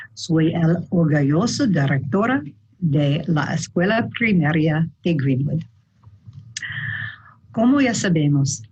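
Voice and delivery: Spanish with a clear American accent; English speaker reading careful Spanish with an American accent. Real, not acted. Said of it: "Perdona mi español".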